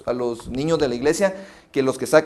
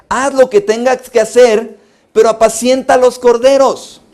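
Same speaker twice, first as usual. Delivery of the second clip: loud, higher preacher's exhortation; forceful preaching; 7 semitones higher, 9 dB louder than usual. Acted. Preacher exhorting pastors to feed the lambs, loud and high. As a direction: drive it home to the pastors.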